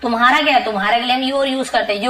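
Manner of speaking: ordinary talk, nothing marked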